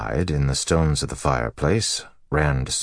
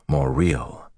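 ordinary delivery, nothing marked

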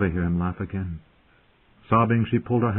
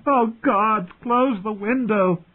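high, drawn-out anguished cry; desperate high-pitched plea; screaming; 14 semitones higher, much slower than usual. A narrator's reading. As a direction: cry out far above your usual pitch. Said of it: "She screamed".

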